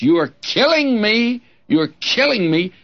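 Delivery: half-hearted imitation of a boy's pained yelling; retold yelling. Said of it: "I would yell at the top of my voice".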